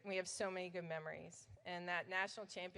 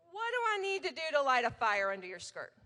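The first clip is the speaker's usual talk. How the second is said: mad coach; 5 semitones higher, 7 dB louder, faster than usual. Retold or imitated. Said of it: "he'd get so mad and he'd go".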